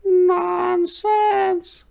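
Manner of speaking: very high squeaky little fish voice; little minnow. A narrator's reading. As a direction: scoff like a tiny fish. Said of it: "said the minnow".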